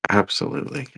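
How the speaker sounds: normal talking voice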